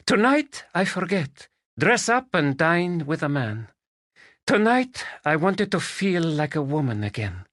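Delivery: put-on high, accented Spanish woman's voice; Spanish lady. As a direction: voice her as a lilting Spanish lady.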